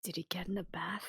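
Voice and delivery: unvoiced whisper; whispered aside. Real, not acted. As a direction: whisper your guess under the comic's act. Player guesses punchline aside.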